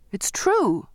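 high-pitched, urgent protest; crying out. A narrator's reading. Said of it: "I cried".

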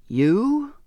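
high, loud scornful question; scornful, sharply rising "You?"; incredulous scorn. A narrator's reading. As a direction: throw it back in disbelief. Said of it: "said with incredulous scorn".

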